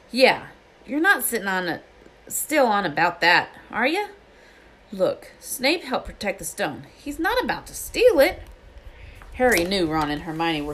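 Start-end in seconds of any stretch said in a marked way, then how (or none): 0.1-8.4 higher, emphatic character voice; Hagrid, exasperated. A narrator's reading. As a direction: brush off the suspicion. Hagrid dismissing suspicion.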